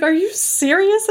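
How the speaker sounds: high, drawn-out disbelief; incredulous. Retold or imitated. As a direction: act out an appalled question. Retells angry stripper's disbelief.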